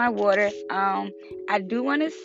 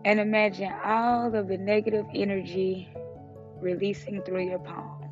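calm breathing guide, fading softer; guided meditation; 6 dB quieter than usual. Acted. Leads a breathing check-in.

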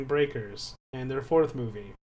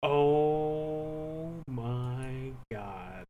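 long drawn-out amazement; very slow, mind-blown reaction; stunned disbelief; much slower than usual. Real, not acted. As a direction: stretch every word out in slow astonishment. Listener stunned by the name fact.

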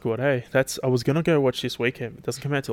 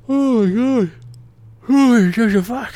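a loud, high burst of amused disbelief at his own slip; amused shock; 11 semitones higher, 7 dB louder, slower than usual. Real, not acted. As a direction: yelp at the blunder. Reacting to own verbal slip.

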